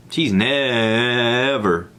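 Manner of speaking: "never" stretched out long; emphatic never. Real, not acted. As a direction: draw out the first vowel of "never". Emphasizes neighbor's unprecedented stop.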